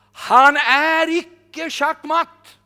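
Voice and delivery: high, loud and very slow proclamation; loud declaration. Retold or imitated. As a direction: announce it to the whole room. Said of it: "säger han". (högt och mycket långsamt)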